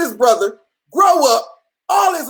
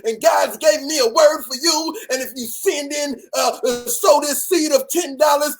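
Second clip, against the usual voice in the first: mock prophet; 2 semitones higher than usual. Prophet on a live calls out viewers, hammed-up preacher voice. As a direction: ham up a TV prophet begging for seed money.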